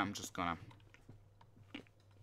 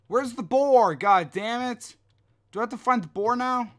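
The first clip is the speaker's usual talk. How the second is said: much higher, louder exasperated shout; frustrated yelling; 10 semitones higher, 7 dB louder than usual. Real, not acted. Player yelling at the game, can't find the boar, exasperated. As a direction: blow up in annoyance.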